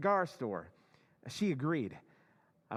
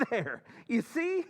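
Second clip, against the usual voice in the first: slow, higher words through a laugh; delighted chuckling boast; laughing triumph; 5 semitones higher, slower than usual. Acted. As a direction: gloat with a laugh at the proof. Walter pleased by the robot's remark.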